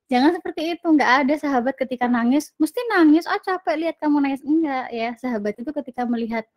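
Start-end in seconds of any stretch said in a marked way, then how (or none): 2.6-4.4 scornful friend. Retold or imitated. Mocking an uncaring friend.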